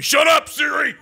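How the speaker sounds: angry yell. Acted. Devil yelling at Siri for butting in, angry shout. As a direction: bark it at the phone.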